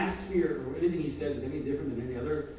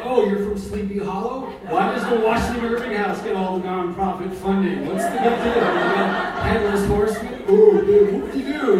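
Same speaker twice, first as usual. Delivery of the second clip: loud, higher, hammed-up indignation; mock a rival with loud scorn; angry ranting; 5 semitones higher, 11 dB louder than usual. Caretaker arguing with rival literary homesteads, comically angry. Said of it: "angry about it".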